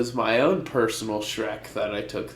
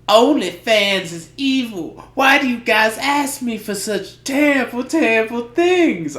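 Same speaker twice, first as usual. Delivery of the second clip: higher, mock Southern Belle accent, playfully scandalised; 9 semitones higher, 4 dB louder than usual. Friend mocks a Detroit girl in a Southern Belle accent. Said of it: "a Southern Belle type of accent".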